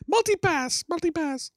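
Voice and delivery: very high-pitched movie catchphrase impression; high little foreign-sounding voice; Lilu. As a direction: squeak it out high, word by word, in an alien accent. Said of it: "Lilu in, uh, The Fifth Element".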